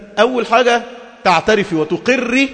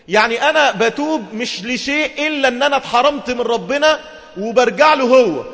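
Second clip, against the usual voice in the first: ordinary delivery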